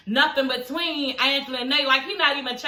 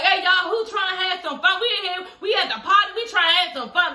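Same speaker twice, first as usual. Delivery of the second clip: high, rowdy imitation of a drunk man; mock-rowdy retold shouting; loud party guy; 8 semitones higher than usual. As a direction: holler like you're riling up the party. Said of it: "He getting all loud".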